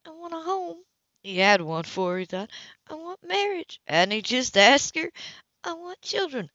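wistful woman. Acted. Woman listing her wishes.

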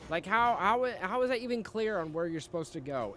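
high, loud exasperated yelling; loud disbelieving rant at bad design; incredulous angry shout. Real, not acted. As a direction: demand an answer from the game, furious. Ranting about unclear level design.

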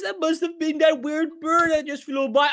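usual speaking voice

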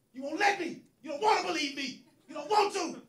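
hammed-up shouting outburst with laughter; mock outburst. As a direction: yell at the interviewer like he's losing it. Acting R. Kelly's outburst at interviewer.

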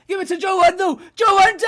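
high, loud urgent yell; excited shouting. Real, not acted. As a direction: scream at them to pass it. Urging players during the attack.

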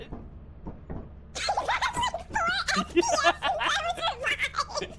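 very high squeaky laughter; long squealing laugh; chipmunk laugh. Real, not acted. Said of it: "You sound like a chipmunk".

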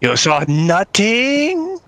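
incredulous. Real, not acted. Disbelieving repeat.

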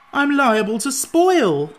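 high, loud, drawn-out protest. A narrator's reading. Jack warns that he may spoil, protesting. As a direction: wail the objection slowly. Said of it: "protested Jack".

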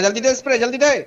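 excited shouting. Real, not acted. Frantic mid-fight callouts.

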